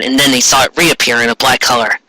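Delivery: ordinary delivery, nothing marked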